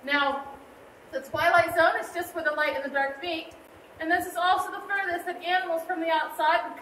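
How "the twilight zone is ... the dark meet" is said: ordinary talk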